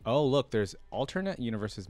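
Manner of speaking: ordinary delivery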